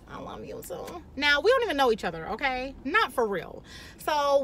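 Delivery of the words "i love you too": breathy whisper reply; much softer, whispered; whispered I love you too. Retold or imitated. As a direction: whisper it back, shy. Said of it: "I was like".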